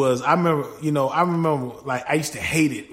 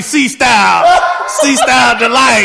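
loud, high hype shout of the nickname; big excited name call; hyped shout; 8 semitones higher, 11 dB louder than usual. Real, not acted. Hyping up his own nickname.

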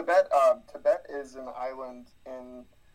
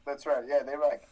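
ordinary delivery

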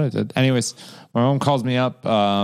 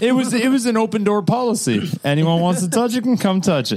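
mock high voice; 11 semitones higher than usual. Joking about open door.

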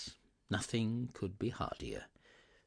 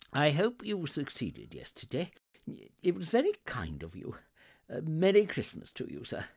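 put-on higher, eager old gentleman; effusive Scrooge; 7 semitones higher, 4 dB louder than usual. Scrooge wishing the gentleman Merry Christmas, effusive. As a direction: greet him with eager goodwill.

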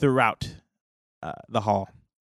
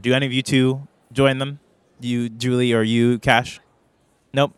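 ordinary delivery

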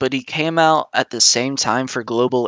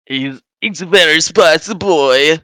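louder, higher hyped-up chant; excited shout; 4 semitones higher, 4 dB louder than usual. Pretend. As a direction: shout the catchphrase proudly. Chanting the catchphrase.

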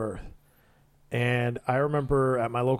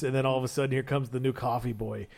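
ordinary talk

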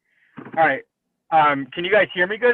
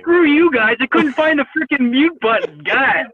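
loud and higher, fed up; frustrated shout; 7 semitones higher, 7 dB louder than usual. Real, not acted. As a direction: yell it out in frustration. Frustrated, couldn't find mute.

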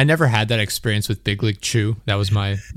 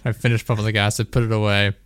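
ordinary delivery

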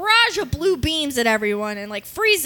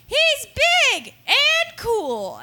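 put-on high, drawn-out baby creature voice; high, slow baby voice; 11 semitones higher, slower than usual. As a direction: gush it out like a tiny alien. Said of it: "and they go".

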